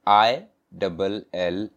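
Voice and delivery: letters "आईडबल एल" spelled slowly, one by one; slow spelling out. Shown as a demonstration. Teacher spelling a word for students, slow and clear. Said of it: "कुछ words में जैसे देख लेते हैं".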